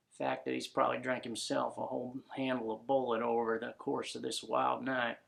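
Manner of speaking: normal talking voice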